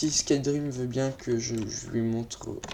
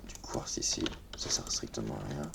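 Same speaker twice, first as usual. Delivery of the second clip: whispered, softer grumble to himself; whispered aside; 11 semitones lower, 11 dB quieter than usual. Real, not acted. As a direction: whisper the complaint so it stays between us. Grumbled aside about friend's build.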